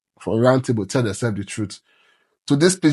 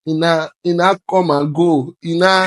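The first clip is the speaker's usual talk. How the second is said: raised, slowed mock of broken speech; mock broken English; 5 semitones higher, 3 dB louder than usual. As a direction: parody halting street talk, slow and high. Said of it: "that people they speak, eh".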